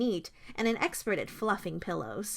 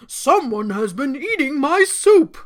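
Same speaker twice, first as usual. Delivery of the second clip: loud, raised Papa Bear shout; shouted outrage; 4 semitones higher, 10 dB louder than usual. A narrator's reading. Papa Bear finding his soup eaten, shouting. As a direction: bellow it in indignation. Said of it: "Papa Bear shouted".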